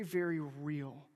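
usual speaking voice

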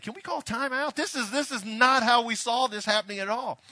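loud, high-pitched comic disbelief; flustered mock protest. Son and [name] react to the parents, flustered mock protest. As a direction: cry out for a halt, comically thrown.

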